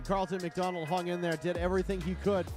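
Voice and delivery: normal talking voice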